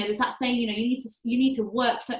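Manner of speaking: ordinary delivery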